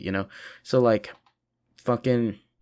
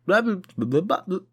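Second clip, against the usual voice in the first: mock babble; 10 semitones higher than usual. Mocking talk as noise.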